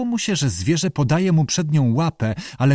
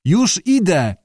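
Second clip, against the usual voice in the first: higher-pitched reassuring call; 7 semitones higher, 3 dB louder, slower than usual. A narrator's reading. Abe runs back to Lee, calling out. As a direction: call it ahead as you come. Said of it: "zawołał".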